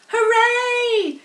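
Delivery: crowd cheering. A narrator's reading. Crowd sees pig get up, loud cheer. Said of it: "yelled the crowd".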